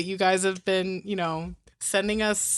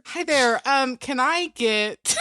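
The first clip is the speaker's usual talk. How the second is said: higher, slowed drive-thru order; customer service voice; 4 semitones higher, slower than usual. Acted. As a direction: chirp a polite, hesitant order. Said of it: "It's my customer service voice".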